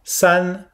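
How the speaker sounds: "san" held high and level; first tone. Shown as a demonstration. Teacher models "san" for students: high level tone. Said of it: "On le fait bien haut".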